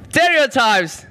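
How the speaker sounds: high loud exclaiming shout; shouted announcement. Acted. Comic opening his bit to the crowd, high loud shout. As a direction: announce the word like a game show host.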